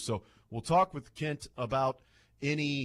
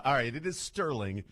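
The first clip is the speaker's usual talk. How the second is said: normal talking voice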